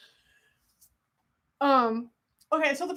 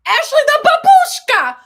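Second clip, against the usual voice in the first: loud, high mock-Russian cry slipping into Italian; bad Russian accent; 12 semitones higher, 13 dB louder than usual. Announcing herself as a babushka, loud fake Russian accent. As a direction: belt out a badly faked Russian accent. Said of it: "I'm trying to do Russian".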